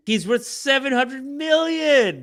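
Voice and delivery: high, slow, stretched-out disbelief; seven hundred million, drawn out in disbelief; incredulous exclaim. Real, not acted. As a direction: stress the whole sum in amazement. Exasperated at calling him just rich.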